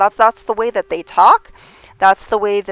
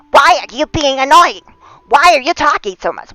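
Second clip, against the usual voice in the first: higher, louder irritated snapping; mock attack; 7 semitones higher, 7 dB louder than usual. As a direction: snap at the talker, imitating. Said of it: "You're like".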